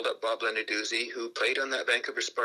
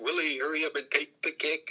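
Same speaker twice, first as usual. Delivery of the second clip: higher-pitched retold urging; urging teammates; 5 semitones higher than usual. Players to Willie with seconds left, lightly acted urging. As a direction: hurry him along, lightly acted. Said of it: "all the players are going".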